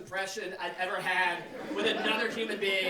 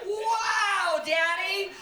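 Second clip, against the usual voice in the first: put-on high, louder, awestruck little girl; 6 semitones higher, 4 dB louder, much slower than usual. Daughter praising daddy's jump, high awestruck little-girl voice. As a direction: gush like an adoring little daughter. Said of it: "she's just like".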